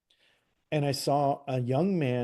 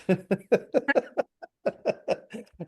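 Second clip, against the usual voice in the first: hearty laugh; 9 semitones higher than usual. Real, not acted. Laughing at own book title.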